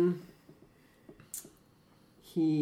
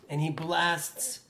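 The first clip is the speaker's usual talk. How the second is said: ordinary talk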